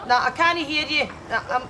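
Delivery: ordinary talk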